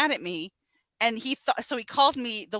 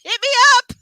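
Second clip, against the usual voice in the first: higher, louder pushy sales voice; hammed-up life coach; 12 semitones higher, 12 dB louder than usual. Mocking Molly offering to fix Johnny's life, loud. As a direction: pitch your help eagerly.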